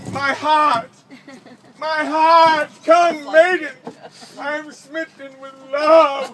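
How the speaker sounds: loud, high, hammed-up lovesick cry; a mock knight yelling his love, loud and high. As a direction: wail it to the maiden, overacted. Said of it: "he would just yell at them".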